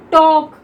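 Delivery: टॉक said loud with an open aw, silent l; talk with aw. Shown as a demonstration. Teacher answering her own question for students, loud. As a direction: model टॉक with a long open aw. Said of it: "हम इसको क्या पढ़ते हैं".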